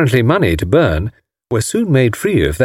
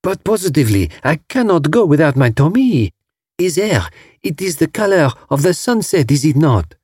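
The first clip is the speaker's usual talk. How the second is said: put-on higher-pitched, dainty foreign-accented English; dainty broken English; 6 semitones higher than usual. A narrator's reading. Marguerite fussing over her Tommy, dainty broken English. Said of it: "Her dainty broken English was fascinating".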